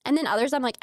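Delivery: ordinary delivery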